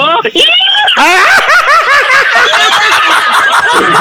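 high burst of laughter at the joke. Real, not acted. Punchline laughter.

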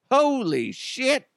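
high, drawn-out shock; mock fright. Sleeper wakes to a staring robot, mock fright. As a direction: stretch it out in comic alarm.

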